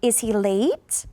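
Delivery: slow, "late" rising at the end; yes-no rise. Shown as a demonstration. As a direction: lift "late" like a yes-no question. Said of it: "yes, no".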